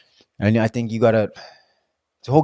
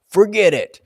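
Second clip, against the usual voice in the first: loud, high punchy forget it; emphatic dismissal; 10 semitones higher, 7 dB louder than usual. Real, not acted. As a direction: slam the punchline.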